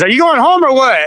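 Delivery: calling through the door, a retold shout; teasing call out. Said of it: "He goes".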